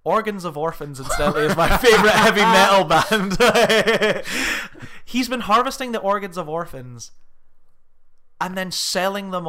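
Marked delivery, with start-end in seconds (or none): 1.0-3.4 laughing. Real, not acted. Joke about a band name. 3.4-5.0 bursts out laughing loud; big loud laugh at the joke. Real, not acted. Reacts to own joke.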